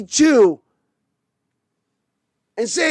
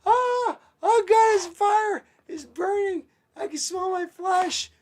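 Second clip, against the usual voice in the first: much higher pained cries; mock burning coach; 9 semitones higher, 4 dB quieter than usual. Fan voicing the coach in pretend flames, high mock agony. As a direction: cry out as if burning.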